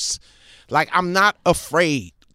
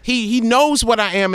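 usual speaking voice

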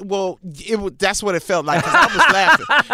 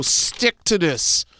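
slow, measured command; lion tamer's order; much slower than usual. Retold or imitated. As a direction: imitate laying down the law, word by word. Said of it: "he'll put it down and go".

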